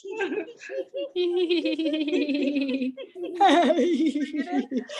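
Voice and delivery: long, high hee-hee wind-up; pent-up exercise giggle; building giggle. Shown as a demonstration. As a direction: giggle on and on, gathering it in. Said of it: "we're gonna do it again".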